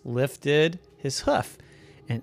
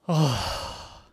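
plain reading voice

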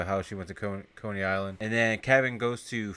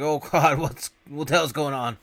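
panicked; 4 semitones higher, 5 dB louder than usual. Retold or imitated. Fred voiced, panicking.

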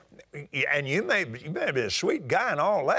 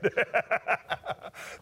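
laugh; 4 semitones higher, 4 dB quieter than usual. Real, not acted. Laughing at the story.

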